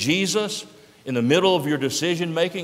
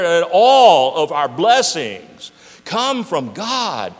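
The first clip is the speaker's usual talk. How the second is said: drawn-out preaching; 4 semitones higher, 9 dB louder, much slower than usual. Acted.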